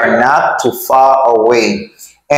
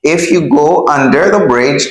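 ordinary talk

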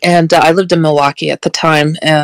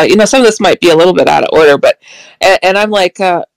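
normal talking voice

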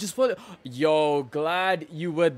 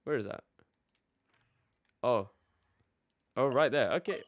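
ordinary talk, nothing marked

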